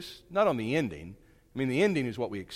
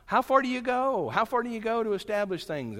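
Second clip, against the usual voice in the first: exasperated question; 11 semitones higher, 4 dB louder than usual. Real, not acted.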